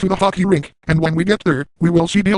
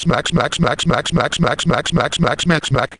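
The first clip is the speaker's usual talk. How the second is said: mouthed smacking noise; 2 semitones lower, slower than usual. Acted. Imitated slapping noise.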